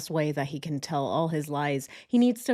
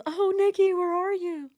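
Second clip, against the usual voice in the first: high-pitched mock wail for his mistress; mock crying husband; 12 semitones higher, 4 dB louder than usual. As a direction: whine it like a hammed-up crybaby. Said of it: "When he cries, he says".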